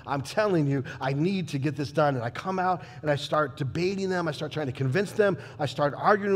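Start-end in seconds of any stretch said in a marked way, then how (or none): none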